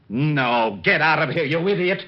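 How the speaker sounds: angry shout. Acted. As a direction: throw him out in a burst of temper. Walt snaps at the intruding cop.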